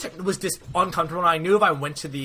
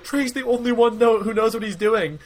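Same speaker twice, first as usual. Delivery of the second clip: mock insistent protest; 7 semitones higher than usual. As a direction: jump in defending Trey. Bus insists Trey is needed.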